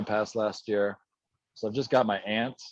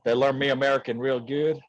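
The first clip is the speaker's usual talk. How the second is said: put-on Southern accent; 3 dB louder than usual. Guest joking about his church sponsors, Southern accent. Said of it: "you transitioned from that accent back".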